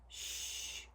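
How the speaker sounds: a sharp whispered shh; breathy shush before the gossip; gossipy shush. Acted. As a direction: hush like a snooty gossip. Gossiping sheep hush.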